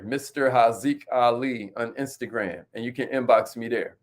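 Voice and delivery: handle repeated slowly; slow, spelled-out handle. Shown as a demonstration. Host repeating his handle for followers, slow and clear. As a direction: draw the name out for the audience. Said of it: "That's my Instagram right there".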